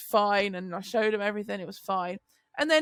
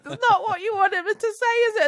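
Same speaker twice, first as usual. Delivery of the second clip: higher, fast, laughing teasing; women in the stalls laugh at the mother; 9 semitones higher, 5 dB louder than usual. Retold or imitated. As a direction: tease through giggles. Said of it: "they're like".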